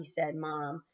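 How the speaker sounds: usual speaking voice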